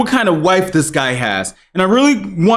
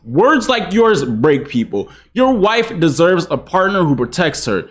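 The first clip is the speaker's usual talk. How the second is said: ordinary talk, nothing marked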